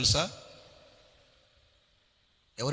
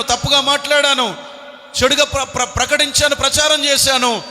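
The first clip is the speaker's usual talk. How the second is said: loud, high impassioned sermon; fiery preaching; 9 semitones higher, 13 dB louder than usual. Real, not acted. Preacher pleading with listeners to repent, shouting. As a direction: preach it at full force.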